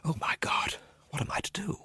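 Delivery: breathless, panicked whisper; frightened whisper. Acted. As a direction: whisper it in rising terror. Panicked thought in the rain.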